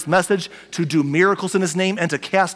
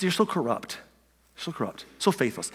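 soft, disappointed reproach; weary exasperation; 2 semitones lower, 8 dB quieter than usual. Retold or imitated. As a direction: mutter it as if worn out by them. Restating Jesus' exasperated rebuke.

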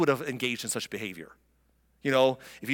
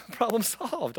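laughing sarcasm; 7 semitones higher, 3 dB quieter, slower than usual. Real, not acted. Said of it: "sarcastic laughing remark".

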